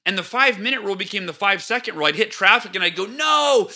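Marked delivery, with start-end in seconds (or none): 3.1-3.7 high, loud, exasperated griping; mock frustrated outburst. Driver in traffic venting at the jam, loud mock frustration. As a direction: act out losing it in traffic. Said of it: "I'd get all upset".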